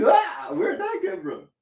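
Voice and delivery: high, slow startled cry; shocked by a sudden burn. Retold or imitated. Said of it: "I was like".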